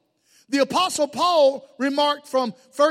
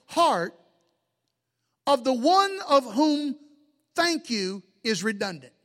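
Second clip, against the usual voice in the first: slow, deliberate phrasing with long pauses; slower than usual. Real, not acted. Preacher repeating his key line for the congregation, slowly. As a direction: spell it out phrase by phrase, unhurried. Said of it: "I'm gonna say it slow".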